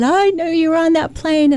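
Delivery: high, tearful cry of relief; crying father. Retold or imitated. Father weeping to his surviving daughter, high and tearful. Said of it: "he screamed and cried".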